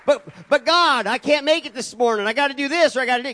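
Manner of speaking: whiny excuse. Retold or imitated. Churchgoer making excuses to God.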